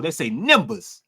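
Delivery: "numbers" said Florida-style as "nimbers", slowly; Florida pronunciation. Shown as a demonstration. Host showing how Florida talks, "numbers" as "nimbuhs". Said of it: "That's how they say in Florida".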